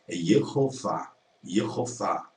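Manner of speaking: Yehová with the h sounded, slow; Yehová, h voiced clearly; correct pronunciation. Shown as a demonstration. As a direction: model Yehová, h not silent. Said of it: "Ela não é muda".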